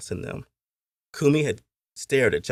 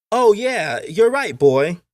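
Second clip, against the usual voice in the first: put-on high, loud boy's voice; young master agreeing; 12 semitones higher, 7 dB louder, much slower than usual. A narrator's reading. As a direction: play a boy catching on, pitched high. Boy agrees with playmate.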